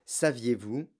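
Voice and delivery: usual speaking voice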